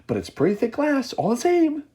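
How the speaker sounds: high, breezy cheerful voice. A narrator's reading. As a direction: shrug it off happily. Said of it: "cheerful as ever".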